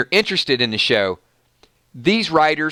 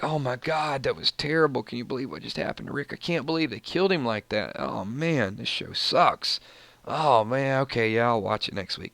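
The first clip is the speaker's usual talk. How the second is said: mock dejected fan; 2 semitones lower, 8 dB quieter than usual. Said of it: "We're all gonna be on here like".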